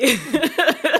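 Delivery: loud bright laugh; happy laugh. Real, not acted. Mother telling she got her baby, laughing loudly. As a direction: let joy burst out.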